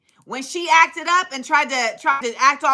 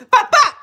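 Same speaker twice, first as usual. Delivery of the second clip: much higher, louder pop pop; imitated punches; 10 semitones higher, 6 dB louder than usual. Describing the two-piece, loud high punch sounds.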